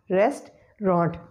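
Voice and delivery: slow "restaurant" in two parts, REST, ront; second syllable dropped. Shown as a demonstration. Teacher showing the hack, "restaurant" slowly split as REST-ront. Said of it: "we will pronounce it as restaurant".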